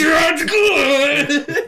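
very high, loud laughing shriek. Real, not acted. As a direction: scream it through laughter. Reacting to chicken joke.

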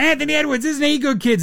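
high presenter voice; hammed-up kids' show host; mock showman. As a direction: pitch the star to kids like a TV host. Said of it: "It's like".

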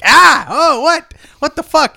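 high, loud startled yelling; shocked yelp. Acted. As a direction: yelp in outraged shock as the patient. Patient reacts to sandwich shoved in.

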